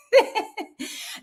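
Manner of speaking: high giggling laugh; amused high laughter; real laugh. Real, not acted. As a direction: keep giggling, pitched high. Laughs on after own remark.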